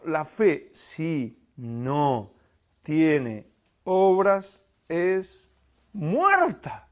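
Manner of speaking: very slow, word by word; super slow reading. Shown as a demonstration. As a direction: read it super slowly for emphasis. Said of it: "súper lento".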